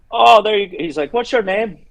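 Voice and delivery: a friendly pub regular doing an Irish lilt; Irish barman. Retold or imitated. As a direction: play the cheery Irish bartender asking your name.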